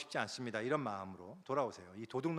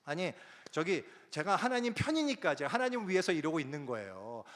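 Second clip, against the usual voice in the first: missionary defending himself to God, rising in anger; indignant prayer; 5 semitones higher, 6 dB louder than usual. Retold or imitated. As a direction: argue with God, getting more heated. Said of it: "막 이러면서".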